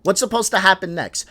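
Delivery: ordinary talk, nothing marked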